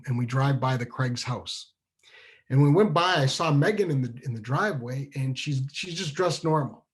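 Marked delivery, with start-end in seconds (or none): none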